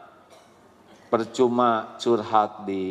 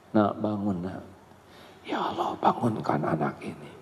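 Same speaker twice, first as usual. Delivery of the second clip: gentle waking; 3 semitones lower, 5 dB quieter than usual. Acted. Mother gently waking child.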